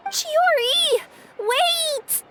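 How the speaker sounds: high call after someone leaving. Acted. Friend shouts after Chiori as she storms off. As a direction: shout to stop her walking off.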